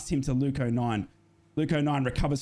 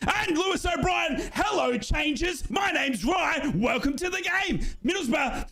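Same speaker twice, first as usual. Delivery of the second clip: excited play-by-play; 9 semitones higher than usual. Acted. Fan commentator cheering the Aussie sub onto the pitch, high excited shout. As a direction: hype the sub coming on like a hero.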